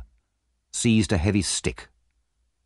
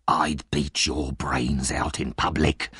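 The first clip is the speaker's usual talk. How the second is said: low, slow whispered threat; menacing whisper; 4 semitones lower, slower than usual. A narrator's reading. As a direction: let the violence seethe under the breath. Sikes furious threat.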